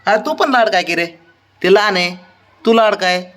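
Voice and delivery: high sing-song coaxing voice for a pet; pet baby talk. Acted. Owner coaxing sulking dog Babdya, high sing-song baby talk.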